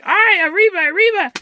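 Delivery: high, loud cartoon mouse cry; Speedy Gonzalez. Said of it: "he's Speedy Gonzalez now".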